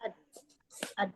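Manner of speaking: "ad" stopped with no breath after the d; sukun on dal. Shown as a demonstration. Student checking dal for breath flow, stopped "ad". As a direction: repeat "ad" separately, closed on the d. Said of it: "ada aliran nafasnya?".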